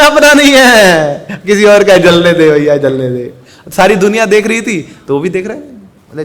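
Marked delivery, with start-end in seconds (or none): 0.0-3.3 gleeful relief. Acted. Relieved father watches the fire burn, gleeful. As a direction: announce it happily, then let it go. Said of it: "खुश हो गया".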